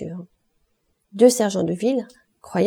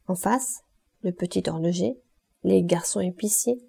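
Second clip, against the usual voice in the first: usual speaking voice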